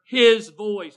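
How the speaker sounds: HIS punched loud and high; preacher's heavy emphasis on HIS; stress on HIS. Real, not acted. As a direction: lean hard on the word "his". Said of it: "putting this emphasis on the word his".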